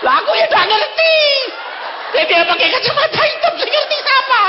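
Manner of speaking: high, loud, rushed retort; exasperated protest. Retold or imitated. Guide arguing with God about the dark glasses, high exasperated shouting. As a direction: protest at full pitch, hammed-up.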